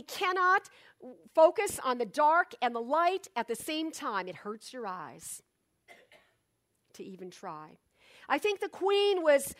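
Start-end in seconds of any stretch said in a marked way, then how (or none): none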